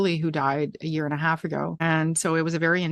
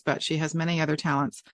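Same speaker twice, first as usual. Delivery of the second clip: ordinary talk, nothing marked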